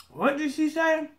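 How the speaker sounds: high, lightly voiced puzzled viewer; puzzled listener. Lightly mocking a viewer who can't understand her. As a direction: ask it like a confused outsider. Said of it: "listen to my videos and say".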